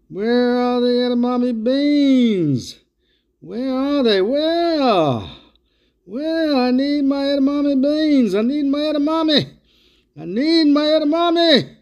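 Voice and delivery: desperately hunting for the beans; frantic searching. Acted.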